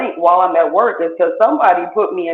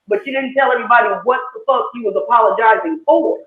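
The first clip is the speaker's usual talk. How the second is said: usual speaking voice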